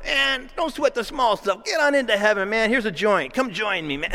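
a lightly voiced laid-back stoner; easygoing pothead voice, lightly done; stoned hippie Jesus. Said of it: "kinda smoking a joint, saying".

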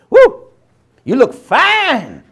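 high gleeful woo, then a slow leering you look fine; mock flirty swoon. Preacher mocking an immature crush, flirty and hammed-up. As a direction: ham up a smitten admirer.

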